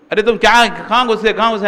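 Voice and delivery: high, loud scolding yell at a newcomer; angry scolding. Retold or imitated. Sadhu yells at a newcomer who pushed in, loud and angry. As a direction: shout at him for barging in. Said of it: "ऐसे गुस्से में उसको चिल्ला दी".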